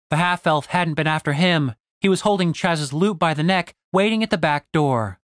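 plain reading voice

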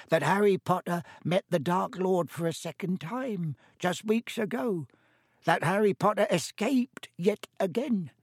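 high-voiced elf, lightly hoarse. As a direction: marvel in a high elfin voice. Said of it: "he said hoarsely".

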